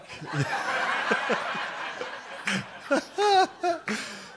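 high, breathless laughter that tails off; long high laugh. Real, not acted. After teasing his wife, a long breathless laugh. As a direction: let the laugh run out.

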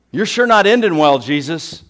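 loud, high taunt; scornful jeer. Acted. Crowd laughing at Jesus as he dies, scornful jeer. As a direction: crow over his downfall. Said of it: "Laughing at him".

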